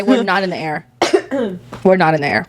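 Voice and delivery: normal talking voice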